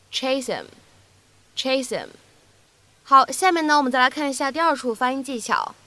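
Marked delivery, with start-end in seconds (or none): 0.1-2.1 "chase him" said as one word, "chase-im"; linked, H dropped. Shown as a demonstration. Teacher repeating for learners, "chase him" with a silent H. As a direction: drop the H of "him" and link it to "chase". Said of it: "字母H好像是没有发音一样".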